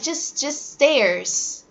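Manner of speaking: very slow gentle correction; teacher correcting plural; patient correction. Shown as a demonstration. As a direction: ease them to stairs. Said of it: "And you're like".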